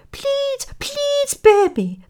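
tiny, very high pleading voice; begging mouse. A narrator's reading. Mouse about to be killed begs the lion, squeaky pleading. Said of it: "the mouse begged".

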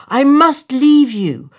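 high, loud, slow declaration; parting swallow. A narrator's reading. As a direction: insist on leaving with drawn-out words. Swallow bids the prince farewell.

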